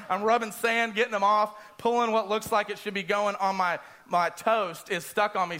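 ordinary talk, nothing marked

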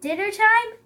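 high, loud question; questioning echo. A narrator's reading. Elephant answers the pouncing Lion, questioning echo. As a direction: echo it back with interest.

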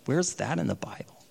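quiet sceptical whisper to himself; skeptical whisper. Retold or imitated. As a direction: mutter it under your breath, unconvinced. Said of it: "And I was like".

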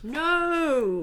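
high, loud exclaimed no; lively firm no; emphatic no. Real, not acted. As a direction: shoot the idea down brightly. Emphatic denial to host.

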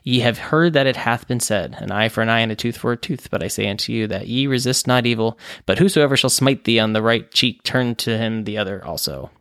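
normal talking voice

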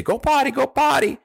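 high, coaxing command to puppy; high potty command. Shown as a demonstration. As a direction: gently urge the puppy on the grass. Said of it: "and you say".

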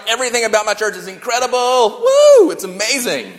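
high-pitched mock enthusiasm with a whooped "Woo"; gushing church fan. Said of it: "you're a little bit drinking the Kool-Aid".

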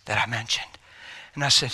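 whispered, confiding; hushed telling. A narrator's reading. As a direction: drop to a secret whisper. Hushed narration of revelation.

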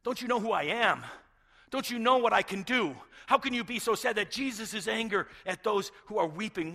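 loud, high-pitched angry rebuke; indignant anger. Acted. Preacher voicing Jesus angry at the mourners, indignant shouting. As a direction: thunder it out in outraged disbelief. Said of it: "Jesus was angry at the people".

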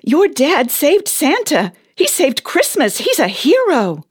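awed excitement. Acted. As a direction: let the awe build as it dawns on you.

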